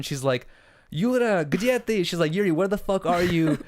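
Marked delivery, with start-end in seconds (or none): 0.9-2.0 higher-pitched retold call in Russian; imitated Russian lady. Retelling the lady's words from the video, imitated Russian lady. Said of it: "the lady basically said in Russian". 2.3-3.6 lightly voiced lady, a bit higher; imitated Russian lady. Translating the lady for the listener, lightly imitated Russian lady. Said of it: "the lady basically said in Russian".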